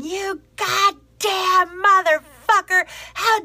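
high, loud mock tirade; imitated angry yelling. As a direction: imitate a furious neighbour ranting at full pitch. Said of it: "she is yelling directly into the sunrise".

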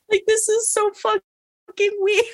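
very high, drawn-out squeaky whine; hammed-up drugged whimper, slowly; mock tearful whine. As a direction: play helplessly bewildered, way up in pitch. Acts out drugged whining to friend.

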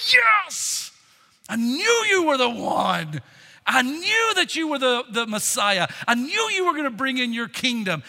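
elated, loud, high burst of triumph; excited disciples. Acted. Disciples thrilled that Jesus is the Messiah, excited. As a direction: cheer it out, pitch leaping up. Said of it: "can you imagine the excitement".

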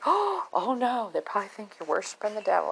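playful retold dismay in a high voice; mock alarm. Retells young lady's mock alarm.

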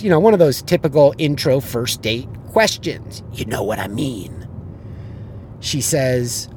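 usual speaking voice

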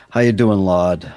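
loud cheerful hello; hearty greeting. Acted. Player greeting the shopkeeper, loud and cheerful. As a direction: call out a big friendly greeting.

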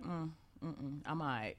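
mumbled under the breath with a small laugh; quiet mumble. Retold or imitated. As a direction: hum it off, half-laughing, low. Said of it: "I was just like".

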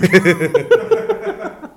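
high, loud laugh; real laughter. Real, not acted. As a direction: laugh out loud at a friend's joke. Laughing at earplug joke. (carcajada aguda y fuerte)

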